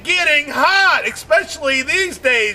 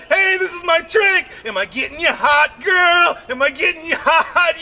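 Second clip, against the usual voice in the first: cocky taunt; 6 semitones higher than usual. Retold or imitated. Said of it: "It's like".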